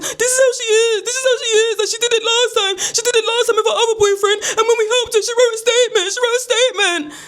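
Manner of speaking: high, whining wail; put-on plaintive high voice; mock upset son. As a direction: imitate him protesting, near tears. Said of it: "He's like".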